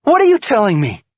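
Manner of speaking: high, loud, fast furious demand; angry demand. A narrator's reading. Wife demands the truth from husband, loud and furious. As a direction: fire the question at him.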